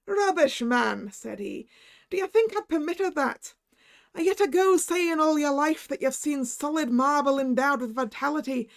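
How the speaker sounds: high, loud scoffing Irish; Irish doctor scoffing. Acted. Irish doctor scolding the narrator, loud scoffing Irish. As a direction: scold him hard in Irish.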